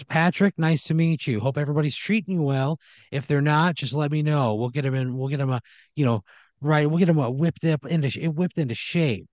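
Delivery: boss welcoming newcomer. Acted. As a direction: play the sweetly patronizing boss. Owner welcomes the new worker.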